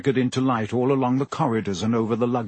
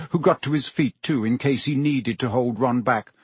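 plain reading voice

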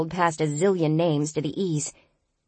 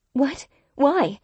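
surprised; 9 semitones higher than usual. Acted. Surprised at odd instruction.

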